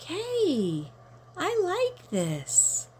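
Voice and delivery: put-on high, slow childlike delight; high drawn-out kid voice; delighted little kid. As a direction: savor it like a happy four-year-old. Child delighted by ice cream.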